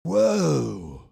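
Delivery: loud, stretched-out stunned whoa; a dazed surfer-dude whoa, blown away; Keanu Reeves whoa. Shown as a demonstration. Streamer answers a super chat's request with a loud Keanu Reeves whoa. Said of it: "my best Keanu Reeves voice".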